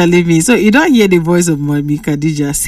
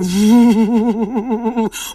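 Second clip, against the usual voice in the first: wavering "vooo" of wind; long wobbly whoosh made by voice; blowing wind noise; 2 semitones higher, 3 dB quieter than usual. Retold or imitated. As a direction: imitate a breeze blowing. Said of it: "breeze dey blow".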